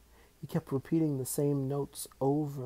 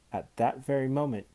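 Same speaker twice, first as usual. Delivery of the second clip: ordinary delivery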